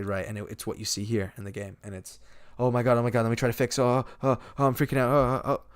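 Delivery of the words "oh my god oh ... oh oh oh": loud, raised, breathless-style frantic acting; hammed-up distraught panic; mock panicked breakdown. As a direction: imitate a man falling apart, piling up the oh's. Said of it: "crying like crazy".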